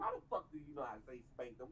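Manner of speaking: ordinary talk, nothing marked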